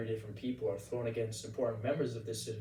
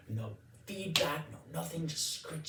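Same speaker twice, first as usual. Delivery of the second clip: normal talking voice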